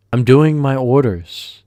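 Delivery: overwhelmed cook shouting back at boss; defensive shout. Retold or imitated. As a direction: call back flustered over the kitchen noise. Narrator answering the yelling boss.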